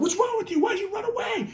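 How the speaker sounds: very high-pitched mock scolding; exasperated, high-voiced telling-off; scolding sister. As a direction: imitate an upset sister in a squeaky high voice. Sister scolding runaway, acted.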